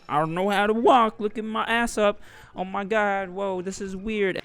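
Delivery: high-pitched mock bewilderment; waking-up freak-out. Gadget waking up in a strange new body, high mock panic. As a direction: act out a high-voiced, dazed panic.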